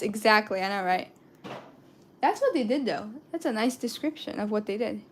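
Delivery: ordinary talk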